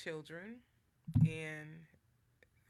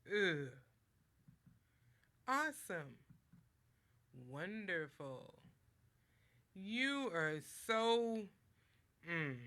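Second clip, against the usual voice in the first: tone for each word; slower than usual. Shown as a demonstration. Speaker shows how tone changes words, acting each one. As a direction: give every word its own emotional color. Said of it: "when I said them, the tone changed".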